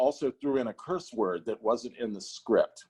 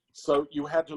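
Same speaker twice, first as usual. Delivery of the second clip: ordinary talk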